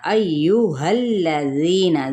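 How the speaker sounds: अय्युहल्लज़ीना recited slowly, each madd stretched; measured tajweed recitation. Shown as a demonstration. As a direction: draw out अय्युहल्लज़ीना syllable by syllable. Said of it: "जी पे या मद्दा का कायदा".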